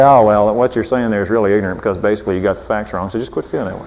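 fast, dropping low and quiet; mock condescending husband. Husband dismissing wife's feelings, mock condescension. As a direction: lecture her off quickly. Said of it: "Husbands say".

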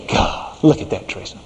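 soft whispered aside to his wife; hushed, smug whisper to Teresa. Retold or imitated. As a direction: whisper it, judging the fathers. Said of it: "and I'd say".

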